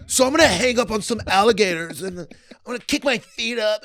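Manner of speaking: high-pitched mock-goth illusionist; Chris Angel. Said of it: "like, goth".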